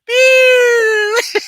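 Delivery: loud high cartoon beep, like Wile E. Coyote falling. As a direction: yelp it high and loud like a cartoon sound effect. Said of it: "and he goes".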